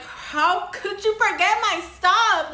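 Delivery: high, loud, drawn-out accusing yell; retold screaming. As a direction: yell it in outrage, re-enacting the row. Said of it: "started screaming".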